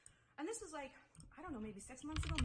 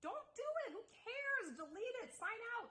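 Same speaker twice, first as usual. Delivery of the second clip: animated advice; 11 semitones higher than usual. Retold or imitated. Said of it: "And I was like".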